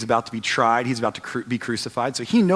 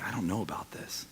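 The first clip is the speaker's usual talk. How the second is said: hushed doubt; 6 semitones lower, 15 dB quieter than usual. Retold or imitated. Doubting believer's inner voice.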